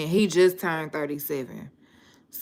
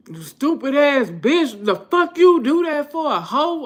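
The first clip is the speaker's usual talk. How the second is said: mock furious yelling; 10 semitones higher, 7 dB louder than usual. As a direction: shout it like him losing it in traffic. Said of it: "And he be".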